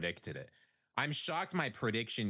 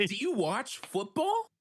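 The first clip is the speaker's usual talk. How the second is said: incredulous; 12 semitones higher, 4 dB louder, slower than usual. Real, not acted. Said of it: "Including me".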